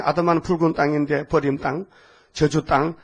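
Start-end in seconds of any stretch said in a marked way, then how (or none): none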